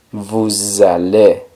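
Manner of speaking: vous allez drawn out as "voo-zzah-LAY"; liaison with Z. Shown as a demonstration. Teacher puts the sound back in, vous allez with liaison. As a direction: slowly link vous allez through a buzzed Z. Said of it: "El sonido que da ahí la S".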